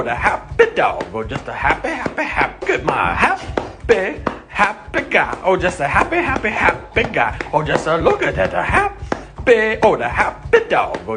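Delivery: normal talking voice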